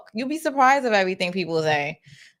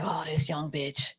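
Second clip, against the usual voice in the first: mock weary grumble, much softer and slower; exhausted; 3 semitones lower, 10 dB quieter, slower than usual. Host voices the man's private reaction, exhausted. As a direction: imitate an exhausted man sighing it under his breath. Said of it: "he sound like he's exhausted".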